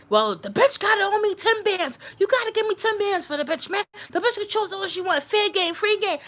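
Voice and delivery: high-pitched mocking imitation of a pimp haggling; retold pimp.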